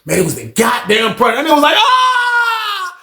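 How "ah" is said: much higher terrified cry; desperate scream. Retold or imitated. Predator screams as if his life is over, desperate. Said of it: "did a scream like his life was over".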